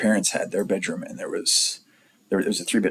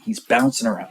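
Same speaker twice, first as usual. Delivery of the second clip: ordinary talk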